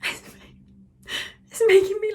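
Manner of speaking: high, slow, laughing through the words; giggling at her own craving. Real, not acted. Craving McDonald's wrap while talking to viewers, laughing. Breaks into laughter mid-sentence.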